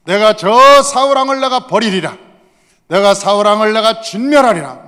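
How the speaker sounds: loud, high furious vow; divine wrath. Acted. God rages at King Saul, furious. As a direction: thunder with rage, punching out each vow. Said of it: "하나님이 화가 나가지고".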